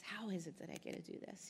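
awed near-murmur repeat. Real, not acted. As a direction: let it drop away softly, half to yourself. Repeats her wondering question.

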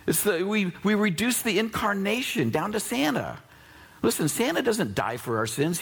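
preaching at full force. Acted. Pastor preaching to the congregation, full force. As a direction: preach it out to the crowd.

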